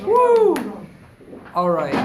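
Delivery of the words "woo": loud high whoop; cheer. Real, not acted. Cheering loudly as a friend finishes a task.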